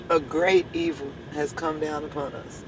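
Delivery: ordinary talk, nothing marked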